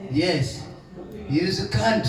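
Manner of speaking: rough Mauritian accent, self-mocking imitation; old Mauritian uncle in a Mauritian accent. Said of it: "my atrocious Mauritius, Mauritian accent".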